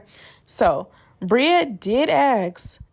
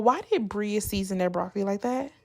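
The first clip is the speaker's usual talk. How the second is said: normal talking voice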